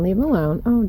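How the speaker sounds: usual speaking voice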